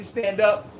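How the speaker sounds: ordinary delivery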